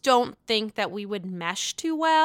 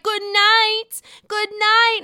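high, loud singsong good night; mock dismissive farewell, pitched up; mocking Candace's goodnight; 10 semitones higher, 6 dB louder, slower than usual. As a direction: toss off a haughty, high goodnight while waving her off. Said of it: "And she's like".

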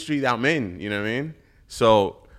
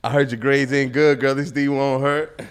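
slow and louder, each word landed; slow punchline; 3 semitones higher, 9 dB louder than usual. Shown as a demonstration. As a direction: deliver the line slow and loud. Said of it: "what, what was it".

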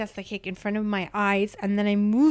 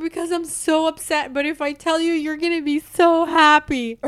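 upset plea; 7 semitones higher, 5 dB louder than usual. Retold or imitated. Quoting herself upset.

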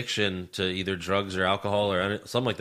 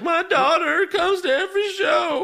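mock crying promo. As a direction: squeal it like a tearful babyface. Mocking crying wrestler's promo.

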